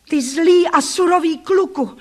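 high, loud accusation; drawn-out indignant shout; outraged cry. A narrator's reading. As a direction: cry out against the bully. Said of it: "vykřikla jsem".

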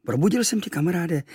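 slightly reproachful. A narrator's reading. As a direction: complain lightly, pitch lifted then falling. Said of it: "řekl trochu vyčítavě". (trochu vyčítavě)

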